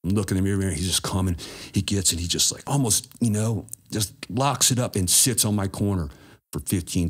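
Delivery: ordinary talk, nothing marked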